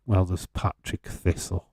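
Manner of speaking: usual speaking voice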